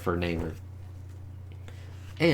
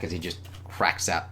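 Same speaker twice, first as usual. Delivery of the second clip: usual speaking voice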